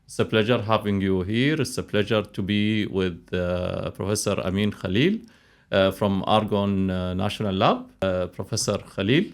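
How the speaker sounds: usual speaking voice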